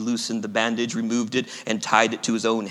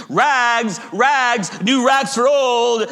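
high, loud hawker's cry; ringing tenor ragman's call; clear calling out; 9 semitones higher, 5 dB louder, much slower than usual. A narrator's reading. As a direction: belt out the wares in long high calls. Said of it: "calling in a clear tenor voice".

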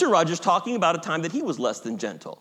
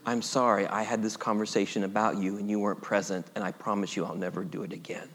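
soft confession; 11 semitones lower, 8 dB quieter than usual. Shown as a demonstration. Modelling a full apology prayer.

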